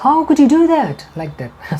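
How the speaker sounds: high, slow, put-on accusing tone; hammed-up disbelief at someone; stretched, high mock reproach; mock outrage.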